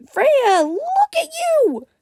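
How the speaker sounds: very high, stretched squeal; excited cry. A narrator's reading. Azzy shooting into a hug with Freya, excited cry. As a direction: squeal it high, loud and slow. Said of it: "she cried again".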